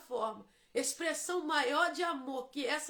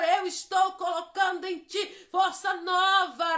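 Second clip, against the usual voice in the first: louder, higher, impassioned preaching climbing to a peak; fiery preaching; 4 semitones higher, 8 dB louder than usual. Acted. Pastor stirring the congregation about grace, louder and impassioned. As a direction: preach it with full fire, almost singing. Preaching builds to full force.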